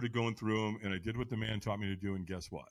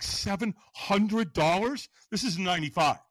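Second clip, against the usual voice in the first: shocked disbelief; 6 semitones higher, 5 dB louder than usual. Retold or imitated. Teller reacts to the ticket price, shocked. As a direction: balk at a price, dragging out the figure.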